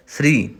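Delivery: ਸ੍ਰੀ quick, half s joined to a full r; one clipped syllable ਸ੍ਰੀ; correct pronunciation. Shown as a demonstration. Teacher settling the right ਸ੍ਰੀ for students, brief and firm. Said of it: "ਸਹੀ ਉਚਾਰਨ ਹੋਏਗਾ ਸ੍ਰੀ".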